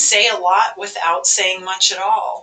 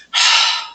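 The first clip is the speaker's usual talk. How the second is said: exasperated sigh. Shown as a demonstration. Staff member sighing at a repeated question, exasperated. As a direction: let the staff's exasperation out in one breath. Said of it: "hear the exasperation".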